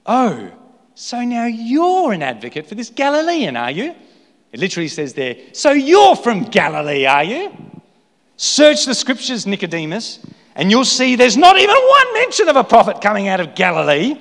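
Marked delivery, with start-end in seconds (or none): 0.1-3.9 high, sneering, accusing question; mock him for siding with Jesus; scornful retort. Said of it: "They argued". 5.5-14.2 high and loud, lecturing with contempt; scornful rebuke. Acted. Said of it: "They argued".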